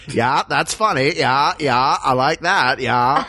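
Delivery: lightly voiced Maine local, slow and easy; a hint of an unhurried Maine yes-man; slow Maine drawl. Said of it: "Or they would go like".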